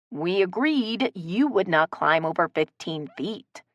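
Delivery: plain reading voice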